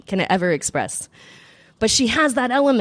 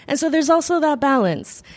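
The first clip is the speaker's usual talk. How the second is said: ordinary talk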